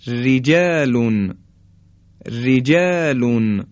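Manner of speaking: رجالون slowly, with a thin R; light R. Shown as a demonstration. (رجالون کشیده با «ر» نازک)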